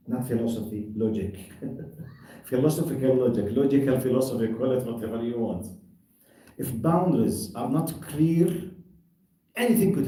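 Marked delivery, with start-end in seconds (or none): none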